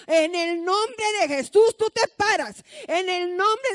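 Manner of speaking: commanding healing prayer. Retold or imitated. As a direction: order the sick man to rise. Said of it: "agarraba el pie enyesado y lo empezaba a mover y decía".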